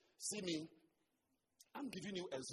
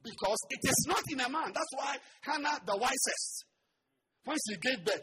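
loud, high preacher's cry; full-force preaching; 9 semitones higher, 12 dB louder than usual. Real, not acted. Preacher exhorting his congregation, loud and high. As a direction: drive the point home to the crowd.

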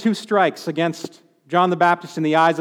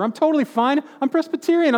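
smug self-assurance; 7 semitones higher than usual. Retold or imitated. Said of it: "tempting religious trapping here".

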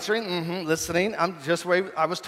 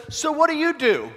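chatty stranger; 8 semitones higher than usual. Retold or imitated. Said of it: "And then he says".

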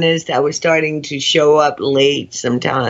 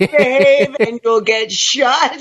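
high, laughing quip; real laughter as she jokes about being shot; 10 semitones higher than usual. Real, not acted. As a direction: laugh through the punchline. Joking about being killed off.

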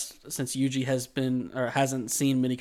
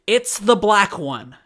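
mock slow snippy customer; 6 semitones higher, 8 dB louder, slower than usual. Retold customer, slow and loud.

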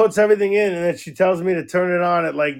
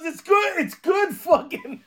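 high-pitched imitation of indignant insisting; hammed-up pleading, pitched way up; mock exasperated protest; 13 semitones higher, slower than usual. Acting the dad's protest over whiskey.

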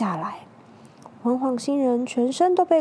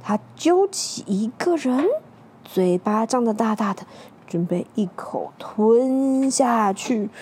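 ordinary delivery, nothing marked

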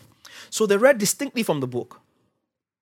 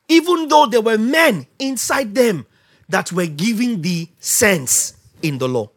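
ordinary talk, nothing marked

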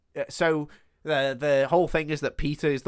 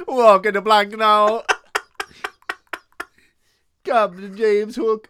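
loud, slow, higher mock Jamaican accent; 6 semitones higher, 7 dB louder, slower than usual. Playing Hook as Sean Paul, in a loud slow accent. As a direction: stretch out a booming silly accent. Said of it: "Why is he [name]?".

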